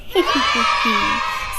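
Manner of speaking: drawn-out hee-hee giggle; gloating cackle. Acted. Tortoise gloats over Hare, wicked little laugh. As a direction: cackle slyly at your victim.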